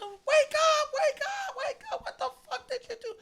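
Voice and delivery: very high screeched urging; mock frantic mom. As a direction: imitate a mom screaming her son awake. Mom screams at passed-out son.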